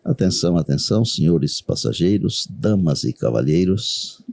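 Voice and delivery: low, measured pilot announcement; over the intercom. Pretend. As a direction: announce it like a captain to passengers. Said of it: "Eu diria mais ou menos assim".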